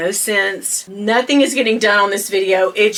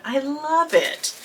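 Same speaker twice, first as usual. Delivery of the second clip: softer, slow awed murmur; soft hushed; 3 semitones higher, 7 dB quieter, slower than usual. Real, not acted. Vlogger admiring the wrapped bag, soft and awed. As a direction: say it under your breath in awe. Quiet then shrieked repeat.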